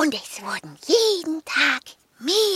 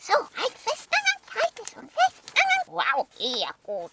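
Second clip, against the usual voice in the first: high dog voice; 9 semitones higher than usual. Acted. Dogs fixing the note.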